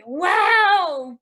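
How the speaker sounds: loud, high wow; stunned by how it all turned out; amazed. Retold or imitated. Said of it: "yo me quedo como que".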